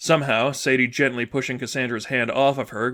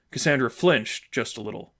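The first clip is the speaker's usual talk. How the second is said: usual speaking voice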